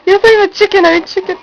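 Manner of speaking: ordinary talk, nothing marked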